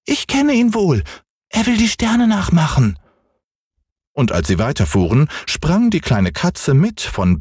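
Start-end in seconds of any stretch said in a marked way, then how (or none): 0.1-3.0 hohe, quengelnde Kinderstimme; kleiner Junge schreit. Acted. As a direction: fordernd wie ein ungeduldiges Kind. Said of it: "sagte Häwelmann". (hoch und fordernd gerufen)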